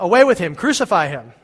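furious crowd yelling; yelled. Acted. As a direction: roar it as an angry crowd. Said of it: "they yelled".

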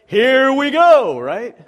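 high, loud, slow drawn-out cheer; party cheer. Retold or imitated. Pilot in beer commercial cheering at beer cart, drawn-out party cheer. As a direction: stretch it out like a party is starting. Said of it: "his response to that is".

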